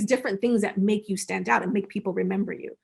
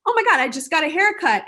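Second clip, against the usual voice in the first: excited friend; 7 semitones higher, 6 dB louder than usual. Retold or imitated. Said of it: "Then you have the".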